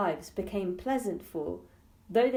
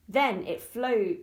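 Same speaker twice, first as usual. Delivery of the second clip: ordinary delivery, nothing marked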